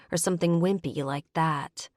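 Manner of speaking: ordinary delivery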